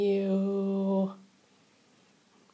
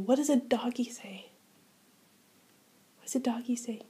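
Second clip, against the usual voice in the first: soft whispered question; whispering; 9 dB quieter than usual. Real, not acted.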